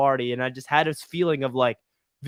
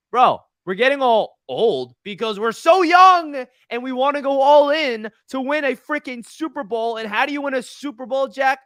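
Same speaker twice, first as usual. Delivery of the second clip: fired-up rant; 9 semitones higher, 6 dB louder than usual. Real, not acted. Fan arguing back at critics, higher and louder. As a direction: hype it up like a fan who's had enough. Heated rant defending Jets signings.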